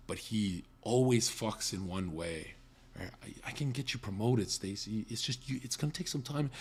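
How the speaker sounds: soft, hushed, coaxing promise; creepy boss voice. Retold or imitated. Predatory boss to his bartender, hushed and coaxing. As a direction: lean in and whisper the bribe.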